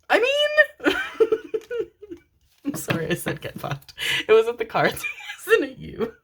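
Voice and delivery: high, helpless laughing apology. Real, not acted. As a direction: laugh through an embarrassed sorry. Laughs after cursing at the cards.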